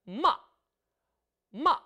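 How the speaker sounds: "마" short, struck hard at the start and dropping; fourth tone demo. Shown as a demonstration. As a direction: hit "마" sharply then drop. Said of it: "사 성은 짧게 첫 음을 탁 쳐주는데요".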